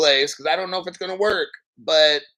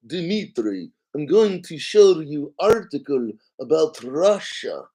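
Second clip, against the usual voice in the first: put-on Russian accent. Showing Dmitry the Russia article in a bad Russian accent. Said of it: "Russian impression was horrible".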